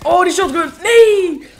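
loud, high-pitched yell of protest; real gamer outburst; dismayed shout. Real, not acted. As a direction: yell out as you get caught, building to a scream of no. Shotgun player attacks him.

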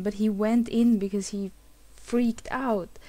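normal talking voice

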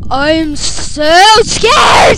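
slow, drawn-out loud scream; demo scream. Shown as a demonstration. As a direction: scream it as loud and long as you can. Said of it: "But I scream loud".

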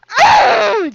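sudden high pained whine; high whiny cry of pain; whimpering cry. Real, not acted. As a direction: yelp like you've just been hit. Hit in game, cries out.

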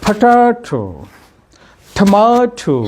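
পটাটো and টমেটো with a long, high middle syllable; English word tune. Shown as a demonstration. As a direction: stretch the middle of পটাটো and টমেটো, then drop. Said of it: "একটা ধাঁচ থাকবে".

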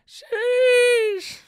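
loud, very high preteen shriek; thirteen-year-old squeal. Shown as a demonstration. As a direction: squeal it far up high, exaggerated. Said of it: "my thirteen-year-old voice showing up".

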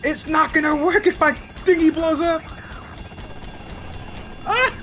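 high, loud panicked outburst; panicking. Real, not acted. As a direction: cry out in a real panic. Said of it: "I'm panicking".

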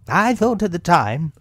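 high, emphatic reproach; lifted, scolding storybook animal voice; Rabbit. A narrator's reading. Rabbit blaming Pooh's appetite, high reproving voice.

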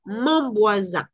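"mon voisin" muy despacio con la n nasal; mon voisin lento. Shown as a demonstration. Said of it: "¿Qué pasa aquí con la N?". (nasal francesa en "mon voisin")

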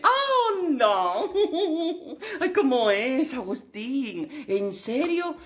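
high, hammed-up gasp of fake shock; mock astonishment. Mocking the guest's obvious remark with fake shock. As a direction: pretend to be amazed by the obvious.

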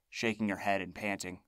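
usual speaking voice

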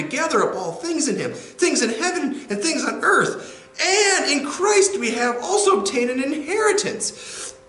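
all in one breath. Shown as a demonstration. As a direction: rush it all in a single breath, climbing to a shout. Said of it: "as this sort of breathless expression".

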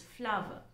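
"flower" softly repeated in two syllables, FLAH-vuh; correct pronunciation. Shown as a demonstration. Teacher repeating the correction for students, correct pronunciation. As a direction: say "flower" quietly, rhyming with power. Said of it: "should not be pronounced as floor".

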